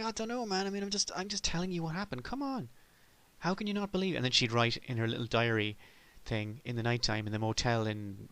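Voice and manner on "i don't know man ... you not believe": much higher, pleading and exasperated; high whiny protest to a sceptical partner; whiny high Mulder. Retold or imitated. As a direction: whine it up high like a desperate believer.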